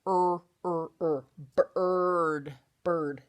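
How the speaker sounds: drawn-out er sound, then bird; vowel sound demo. Shown as a demonstration. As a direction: stretch the er before saying bird. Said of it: "the I says".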